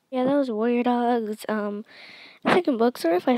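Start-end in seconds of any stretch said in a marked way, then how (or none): none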